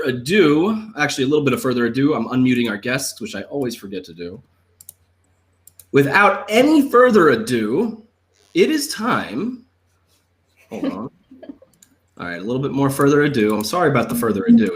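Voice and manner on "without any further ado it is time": slow, drawn-out grand announcement; milk it like a ringmaster; showman announcer. Acted.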